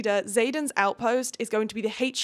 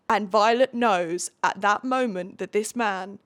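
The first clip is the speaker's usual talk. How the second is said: ordinary talk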